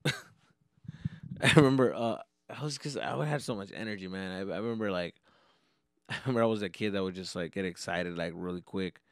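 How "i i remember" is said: ordinary talk, nothing marked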